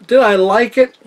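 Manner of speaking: high, loud put-on question; exaggerated high-voiced rhetorical question; mock self-questioning. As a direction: pitch it way up, asking yourself aloud. Reviewer poses himself the verdict question.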